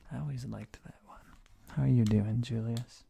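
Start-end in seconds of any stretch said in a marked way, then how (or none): none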